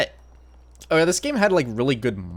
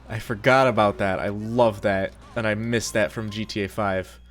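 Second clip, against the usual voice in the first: ordinary talk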